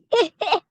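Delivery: sudden loud high laugh; high burst of laughter. Real, not acted. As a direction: crack up out loud. Laughs at own card.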